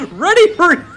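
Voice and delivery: high, loud goofy cartoon voice, laughing into it. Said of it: "reading that in Goofy's voice".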